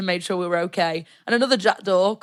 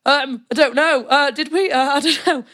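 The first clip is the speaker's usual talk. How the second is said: high, loud, flustered denial; mock innocent I don't know; feigned innocence; 8 semitones higher, 6 dB louder than usual. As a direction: play dumb, nervously laughing. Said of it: "I was like".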